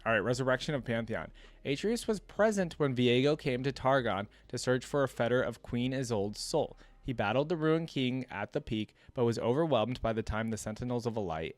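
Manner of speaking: ordinary delivery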